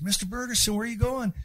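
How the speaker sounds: imitating a schoolkid asking the teacher; curious kids. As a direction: ask like a curious eleven-year-old.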